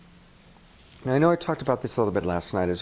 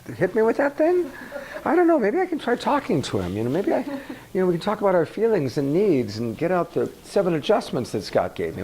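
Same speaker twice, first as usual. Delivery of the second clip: mock dithering; 7 semitones higher than usual. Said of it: "you don't have time to go".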